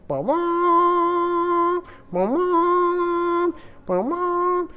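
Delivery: long, high held horn blasts; trumpet-like vocal blasts, drawn out; war horn imitation. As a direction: blare it out like a battle horn. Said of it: "blowing the horn of war".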